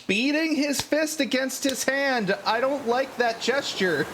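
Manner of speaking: very high-pitched sidekick, wary; high character voice, uneasy about a stranger; Wutso. Acted. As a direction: pitch it way up as a disapproving little servant. Sidekick reacts to the threatening gesture.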